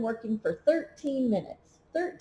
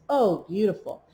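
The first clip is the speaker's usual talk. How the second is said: ordinary delivery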